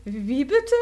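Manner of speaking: loud, startled disbelief; shocked. A narrator's reading. Yui can't believe Heidi accepts, loud startled question. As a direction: blurt it out in shock.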